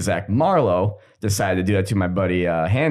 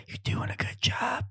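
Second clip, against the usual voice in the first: whispered right behind his ear; a soft sneaky whisper of praise; 11 dB quieter than usual. Retold or imitated. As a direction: creep up and breathe it out in a whisper.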